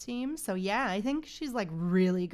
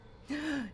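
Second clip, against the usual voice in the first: gasp; 4 semitones higher, 4 dB quieter than usual. Real, not acted. Streamer reacts to villain reveal.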